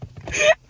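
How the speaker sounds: a sudden high laugh at herself; high burst of laughter. Real, not acted. Laughing at her own TikTok quote, high burst of laughter.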